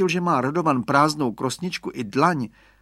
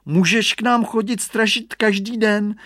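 put-on child's voice; 8 semitones higher, 5 dB louder than usual. A narrator's reading. Radovan speaks to Hugo.

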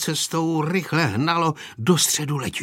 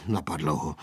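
plain reading voice

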